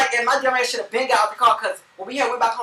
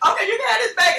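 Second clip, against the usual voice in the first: high, loud frightened squeal; panicked high-pitched give-up; scared squeak; 13 semitones higher, 6 dB louder than usual. Retold or imitated. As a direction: squeal it out like a terrified victim. Handing purse over to robber.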